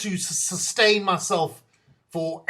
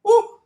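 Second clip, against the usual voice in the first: high ooh; 10 dB louder than usual. Real, not acted. Reacting to imagined honesty.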